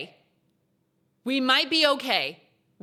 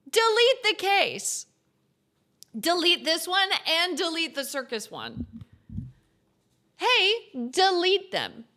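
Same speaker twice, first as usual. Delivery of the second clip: high, insistent plea; exasperated demand; 7 semitones higher, slower than usual. Real, not acted. Player wanting the circus case gone too, insistent. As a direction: insist on it, pitched well up. Demands the creepy cases be removed.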